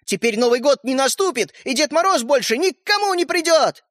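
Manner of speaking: high, dire accusation; calling out angrily. A narrator's reading. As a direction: pile on the blame, pitch climbing. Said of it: "прокричал ей Родька из коридора". (выкрикивая обвинения)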